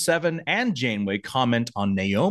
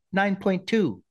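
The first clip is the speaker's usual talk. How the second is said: lightly voiced judge announcing a score, higher at the end; Olympic judge; 9 semitones higher, slower than usual. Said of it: "like an Olympic judge".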